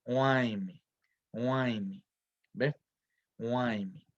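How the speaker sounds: huaymi, slow and careful, falling each time; pronouncing huaymi. Shown as a demonstration. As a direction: say huaymi slowly, stressing the ai. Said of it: "a los nobes se les llama".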